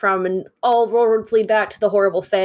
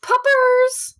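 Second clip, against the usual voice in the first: excited. Real, not acted. Greeting the dogs coming home.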